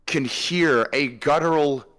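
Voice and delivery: slow, ominous scene-setting narration; game master narration. Acted. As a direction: set the scene slowly and darkly. GM describes the orc horde.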